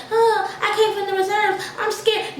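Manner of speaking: mock scared newcomer. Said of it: "and I'm just gonna be like".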